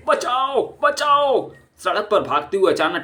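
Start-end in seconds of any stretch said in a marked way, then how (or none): 0.1-1.5 high panicked yelling; terrified shouts for help; cries for help. Acted. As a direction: scream for help as the dog bites. Thug still fleeing the dog.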